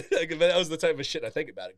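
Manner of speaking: normal talking voice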